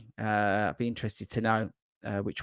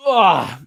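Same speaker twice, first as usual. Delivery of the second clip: a loud sneeze; sneezing; 7 semitones higher, 9 dB louder than usual. Real, not acted. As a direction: let out a real sneeze. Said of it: "And I am gonna sneeze".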